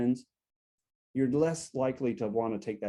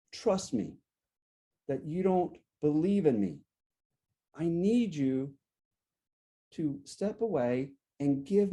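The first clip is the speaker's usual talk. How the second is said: deliberate phrases with long pauses, slightly raised pitch; measured win-strategy demo. Shown as a demonstration. Coach modelling the win strategy to a partner, measured. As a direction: spell out your feelings carefully, pausing between phrases. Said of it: "how do we use the when strategy".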